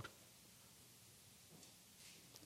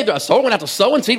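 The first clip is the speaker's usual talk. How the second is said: mocking laugh; 7 semitones higher, 4 dB louder than usual. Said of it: "And you say".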